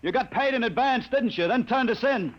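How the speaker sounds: high, indignant outburst; angry accusation. Acted. Cheated stowaway accusing his betrayer, angry and indignant.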